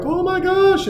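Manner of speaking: high mock child's cry of dismay; upset little girl. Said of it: "and she's like".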